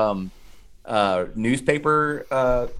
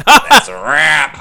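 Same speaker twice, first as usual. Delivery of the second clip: loud, drawn-out mock movie line; hammed-up one-liner; 8 dB louder, much slower than usual. Co-host repeating camera head's line, hammed-up and loud. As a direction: belt it out slowly, hammed up.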